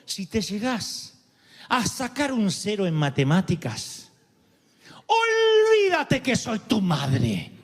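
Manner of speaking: slow, measured warning building to a drawn-out outburst; mock maternal threat. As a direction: imitate mom's joking ultimatum, stretching every word. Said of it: "en tono de broma". (amenaza materna en broma, lenta y teatral)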